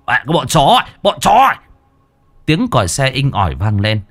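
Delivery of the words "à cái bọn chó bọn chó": furious cry climbing much higher and louder; angry cursing. Acted. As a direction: spit out the insult, rising to a yell. Cursing the attackers mid-fight. (chửi rủa giận dữ, gào cao dần)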